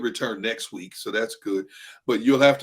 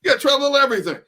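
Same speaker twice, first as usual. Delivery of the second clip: higher, louder, fast and laughing; hyped-up pal; 11 semitones higher, 5 dB louder, much faster than usual. Retold or imitated. Eighties buddy hyping him to try espresso, loud and laughing. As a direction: sell it like an eighties party guy.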